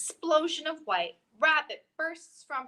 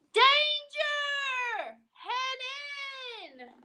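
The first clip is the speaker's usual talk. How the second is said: warning scream; 12 semitones higher, slower than usual. Acted. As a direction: shout the alarm to the family. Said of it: "he screams".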